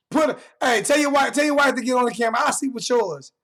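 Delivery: loud, fast, laughing taunt; laughing taunt shout. Real, not acted. Taunting a viewer in chat, loud and laughing. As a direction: yell the jab through a laugh.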